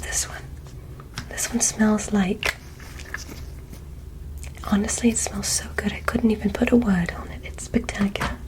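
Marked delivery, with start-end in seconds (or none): none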